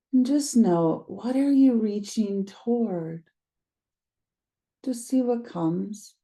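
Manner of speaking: calm guided meditation read, unhurried pauses. Acted. Teacher guiding listeners through a grounding practice, meditation voice. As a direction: lead them gently into the body.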